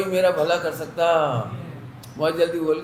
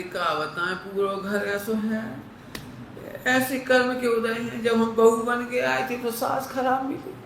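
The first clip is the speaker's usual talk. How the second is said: lightly voiced weary grumbling, softer and slow; mock household complaining; 3 semitones higher, 4 dB quieter than usual. Preacher imitating a scholar griping at home, lightly mocking. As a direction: sigh out the old family complaint. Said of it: "और घर जाते थे".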